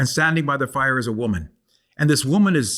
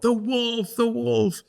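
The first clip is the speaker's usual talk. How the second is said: put-on children yelling; 10 semitones higher, much slower than usual. Storyteller imitating children calling out the answer, high and childlike. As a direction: call out like a room of eager kids. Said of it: "the children all yell out".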